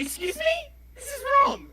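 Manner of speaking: hammed-up proper Brit; mock indignant. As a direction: act scandalised by bad grammar. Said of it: "I'm just like".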